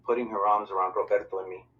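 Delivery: plain reading voice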